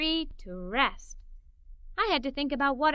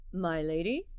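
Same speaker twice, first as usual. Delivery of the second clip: lower, softer courtly voice; a hint of a gallant father; 9 semitones lower, 5 dB quieter than usual. Father pulling out mother's chair, mock courtly. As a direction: offer the chair grandly. Father plays fancy waiter.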